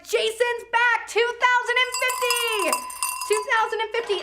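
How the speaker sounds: high, loud, stretched-out bid call; excited shout. Real, not acted. Auctioneer announces the bidder is back, excited shout. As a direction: holler the big number triumphantly.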